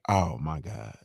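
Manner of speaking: breathless whisper of shock; a stunned whispered "oh my God"; whispered disbelief. Retold or imitated. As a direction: hush it out, appalled. Reacting to the fishnet.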